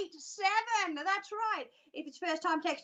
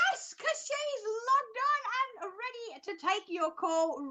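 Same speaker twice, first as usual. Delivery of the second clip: high, eager phone-answering voice; eager receptionist; 6 semitones higher, 5 dB quieter than usual. Acted. Receptionist telling a caller the psychic is ready, eager acting.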